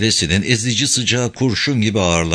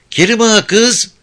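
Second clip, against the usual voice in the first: higher, louder coaxing call with rise; coy rising call; 11 semitones higher, 4 dB louder than usual. Acted. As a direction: call again, sweeter and higher. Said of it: "Güldane nazlı nazlı seslendi". (nazlı, yükselen seslenme)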